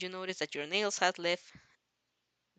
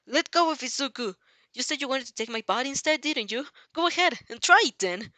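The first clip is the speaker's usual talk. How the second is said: louder, higher, furious challenge; angry shout; 5 semitones higher, 6 dB louder than usual. A narrator's reading. Girl yells at the slime villain to let her friend go, furious. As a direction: yell at the villain to let him go. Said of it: "you scolded".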